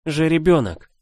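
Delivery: high trembling sob of a word; sobbing out. Acted. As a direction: choke it out through tears. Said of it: "только и смогла вымолвить она, содрогаясь".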